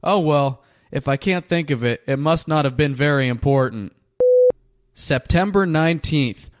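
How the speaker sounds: ordinary talk, nothing marked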